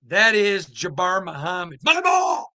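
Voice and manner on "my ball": high-pitched shout for the ball; loud, high mimicked yell; imitated yell. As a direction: holler it out like a player claiming it. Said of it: "he'd yell".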